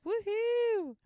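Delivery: high, loud mock cheer of a wedding crowd. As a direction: whoop it up like the guests. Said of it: "Everybody's like".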